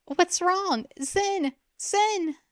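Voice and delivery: frantic sister. A narrator's reading. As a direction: call her name in alarm. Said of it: "Ren screamed".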